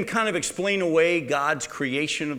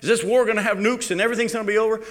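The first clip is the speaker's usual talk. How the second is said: mock-worried questions, higher and rushed; alarmed public questions; 7 semitones higher, 3 dB louder, faster than usual. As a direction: imitate people fretting in a hurried, raised voice. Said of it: "People saying".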